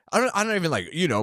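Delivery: ordinary talk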